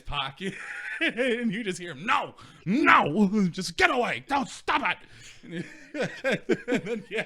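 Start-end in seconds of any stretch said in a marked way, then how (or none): 2.1-5.0 high, loud mock panic shout; retold scuffle shout. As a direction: yell it as if caught mid-fight.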